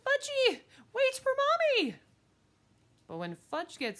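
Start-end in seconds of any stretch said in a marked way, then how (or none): 0.0-1.9 very high, loud mother's call; shrieked call across the park; hollering. Acted. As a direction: holler after a runaway toddler.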